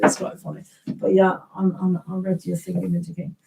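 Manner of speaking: usual speaking voice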